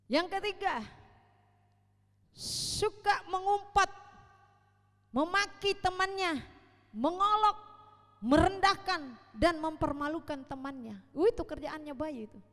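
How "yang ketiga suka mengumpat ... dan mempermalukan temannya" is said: loud, driving pulpit delivery; preaching at full force. Acted. Preacher lists childish traits to the congregation, at full force. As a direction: preach it out to the whole hall.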